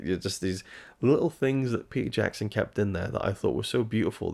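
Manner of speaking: usual speaking voice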